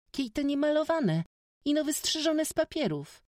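put-on high, loud young girl explaining; proud village girl setting him right; eager peasant girl. A narrator's reading. Józka correcting the stranger, high girlish pride.

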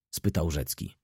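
ordinary delivery

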